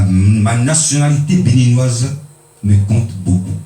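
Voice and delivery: normal talking voice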